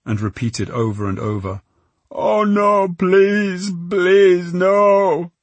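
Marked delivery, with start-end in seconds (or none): 2.1-3.7 sobbing plea. Acted. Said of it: "He dribbled and sobbed". 3.9-5.3 sobbing plea, louder. Acted. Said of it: "He dribbled and sobbed".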